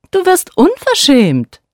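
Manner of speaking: indignant high outburst; angry. A narrator's reading. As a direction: snap at him, offended. Queen flares up in anger.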